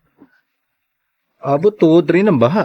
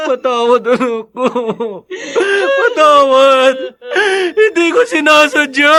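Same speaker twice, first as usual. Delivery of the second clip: crying apology; 9 semitones higher than usual. Acted. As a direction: break down begging forgiveness. Father begs dead child's forgiveness. (humahagulgol na paghingi ng tawad)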